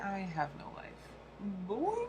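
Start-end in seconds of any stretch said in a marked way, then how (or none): 1.7-2.1 Boing squeaked very high with a rising tune; squeaky high Boing. Pretend. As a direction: chirp Boing up high like a cartoon spring. Repeats Boing again, much higher.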